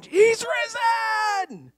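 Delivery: long, high, drawn-out cry; stretched exultant yell; wake-up shout. Retold or imitated. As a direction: holler it to wake your wife. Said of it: "I woke her up going".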